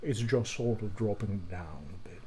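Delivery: low, soft British accent with the jaw dropped. Shown as a demonstration. Showing a colleague the British jaw drop, low dropped-jaw British accent. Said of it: "dropping your jaw when you do it".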